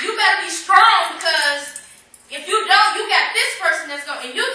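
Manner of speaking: fiery preacher's call; preaching at full force. Acted. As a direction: shout it to the church.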